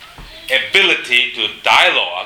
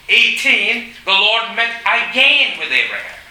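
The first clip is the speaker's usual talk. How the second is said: rising preacher's fervour, pitched higher; preaching; 6 semitones higher than usual. Acted. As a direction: press the point home to the congregation.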